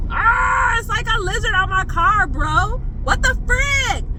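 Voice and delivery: high-pitched alarmed cry; panicked, voice shooting up; freaked out. Real, not acted. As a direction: shriek it in real alarm. Lizard on her car.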